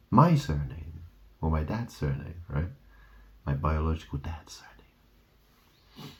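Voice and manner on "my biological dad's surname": hushed, slow whisper of a private detail. Real, not acted. Whispering to viewers about his biological dad. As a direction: drop to a whisper as if sharing a secret.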